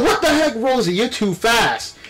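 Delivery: normal talking voice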